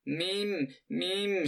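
put-on high happy grunts of a girl who cannot speak; happy grunting. A narrator's reading. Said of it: "she kept grunting".

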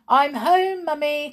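high, loud arrival call; child calling out cheerily for a parent. A narrator's reading. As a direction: sing out to someone in another room. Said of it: "called Pam".

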